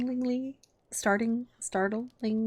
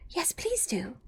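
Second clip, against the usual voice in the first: soft whispered plea; pleading; 5 semitones higher, 5 dB quieter, slower than usual. A narrator's reading. Alice pleading with the Dormouse softly. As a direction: beg gently. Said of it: "pleaded Alice".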